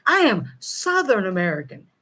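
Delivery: ordinary talk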